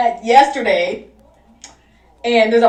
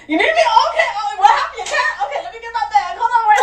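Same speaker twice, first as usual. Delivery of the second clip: high-pitched mock-worried phone voice; hammed-up urgency, laughter creeping in; fake alarm; 10 semitones higher than usual. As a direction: overact a sudden emergency call. Acting a fake emergency phone call.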